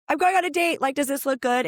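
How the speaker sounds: high, eager asking for approval; woman asking the group about her date outfit, high and eager; excited friend. Retold or imitated. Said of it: "that's like".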